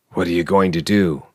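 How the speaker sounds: ordinary delivery, nothing marked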